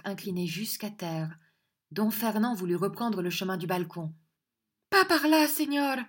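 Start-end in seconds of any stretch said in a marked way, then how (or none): 4.9-6.0 high, loud proud order; proud command. A narrator's reading. Angèle stopping Fernand at the balcony, proud command. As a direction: stop him with pride. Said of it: "avec fierté".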